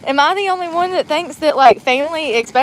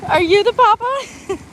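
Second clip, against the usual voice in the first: giggling mock question; 8 semitones higher than usual. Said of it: "I'm laughing so hard".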